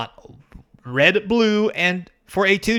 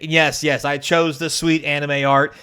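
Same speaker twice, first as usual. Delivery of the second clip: normal talking voice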